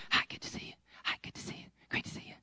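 breathy, rushed mock hellos under the breath; hurried whispered greetings. Pastor mocking latecomers' rushed hellos, whispered. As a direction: toss off greetings in a whisper as you pass by.

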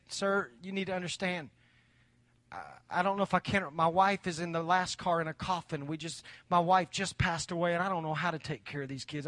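soft, low, halting widower; hushed, sorrowful retold confession; subdued grief. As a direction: answer quietly, weighed down by loss. Grieving father's reply retold.

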